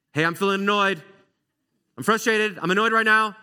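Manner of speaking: loud, high-pitched annoyed outburst; sfogo irritato. Acted. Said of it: "to the person annoying you".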